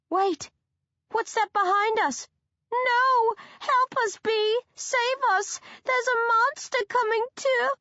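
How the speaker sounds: very high fake screams; hammed-up damsel screams, loud; false screaming. A narrator's reading. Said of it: "high-pitched and false".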